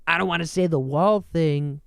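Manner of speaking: ordinary talk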